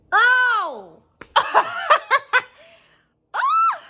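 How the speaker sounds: 2 high, loud "Oh!" breaking into laughter; 3 gasp in disbelief and crack up; 1 shocked laughter. Real, not acted. 4 vlogger stunned by what the guest did, high laughing shock.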